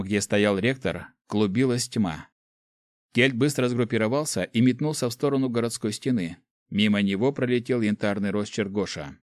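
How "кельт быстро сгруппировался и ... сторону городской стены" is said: plain reading voice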